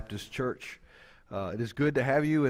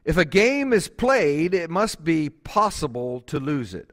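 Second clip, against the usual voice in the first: louder and higher, full pulpit force; preaching; 3 semitones higher, 5 dB louder than usual. Acted. Pastor quoting Lewis to the congregation, building preaching. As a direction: drive the Lewis quote home to the church.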